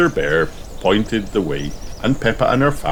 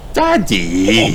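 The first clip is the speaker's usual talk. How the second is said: high giggling scold; laughing tease at Daddy; 4 semitones higher, 5 dB louder than usual. A narrator's reading. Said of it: "Peppa giggled".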